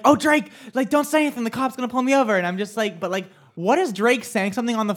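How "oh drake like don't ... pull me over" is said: high, frantic plea; a panicked whisper-shout to a friend. Retold or imitated. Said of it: "I was like".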